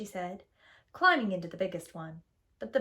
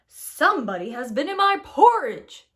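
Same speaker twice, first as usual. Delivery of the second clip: loud, booming big bear; Papa Bear booming; 6 semitones higher, 12 dB louder than usual. Acted. Papa Bear finding his porridge touched, loud booming. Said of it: "said Papa Bear".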